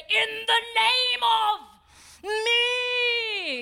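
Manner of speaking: high, drawn-out hammed-up shout; mock grandiose command. Preacher parodying what Jesus didn't need to say, mock grandiose shout. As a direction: stretch each word into an overblown bellow. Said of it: "Jesus didn't have to say".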